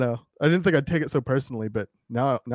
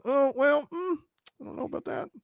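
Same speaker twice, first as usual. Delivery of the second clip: mock hesitant doubt; 11 semitones higher, 9 dB quieter than usual. Imagined inventors eyeing diamonds made from people, mock hesitant. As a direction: start high, then drop to a barely voiced murmur.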